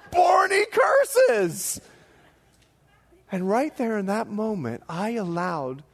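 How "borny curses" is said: gleeful shout. Retold or imitated. Rowing buddy announcing the curse, slow gleeful shout. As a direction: crow it out to everyone, drawn out.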